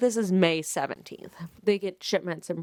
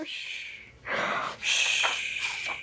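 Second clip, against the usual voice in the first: hushing; 13 semitones higher, 6 dB quieter, much slower than usual. Real, not acted. Hushing herself.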